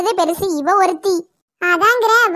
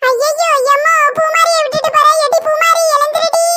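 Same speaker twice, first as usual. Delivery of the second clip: high, frantic cries; panicked cry to a sleeping friend; panicked shout; 8 semitones higher than usual. Acted. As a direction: shriek for her to wake up and run. Panicked flight, calling friend.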